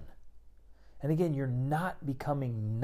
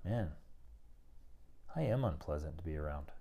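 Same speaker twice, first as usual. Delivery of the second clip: humble admission; 6 semitones lower, 8 dB quieter than usual. Retold or imitated. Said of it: "You say".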